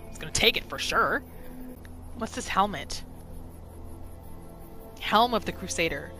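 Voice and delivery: ordinary talk, nothing marked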